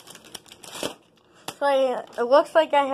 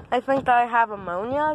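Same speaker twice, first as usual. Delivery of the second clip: ordinary talk, nothing marked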